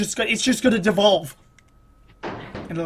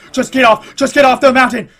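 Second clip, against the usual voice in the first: loud demand, building higher; frustrated urging; 4 semitones higher, 10 dB louder than usual. Real, not acted. Player urging the climber on, frustrated and louder. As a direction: build from stammer to raised-voice demand. Pleading with the game.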